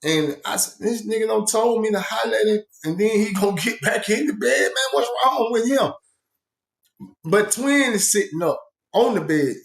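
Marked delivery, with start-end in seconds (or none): 0.8-5.9 high, incredulous complaint; mock disbelief, pitched up high; exasperated. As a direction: act baffled that he's back in bed. Exasperated retold quote.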